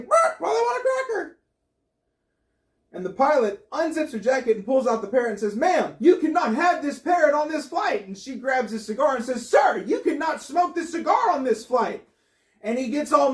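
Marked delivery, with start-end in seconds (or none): 0.0-1.4 very high squawking parrot voice. Acted. Parrot repeats its line. 5.5-8.1 higher, firm scolding; stern pilot. Acted. 9.5-12.1 higher, tit-for-tat scolding; mocking retort. Woman throws the rule back.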